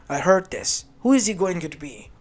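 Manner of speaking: loud demand. Acted. Ares barges in demanding.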